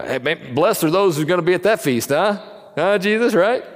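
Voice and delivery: high, loud eager dinner guest, pushing for a nod; blurting it out to get Jesus to agree. Retold or imitated. Guest at the table blurting to Jesus, high and eager.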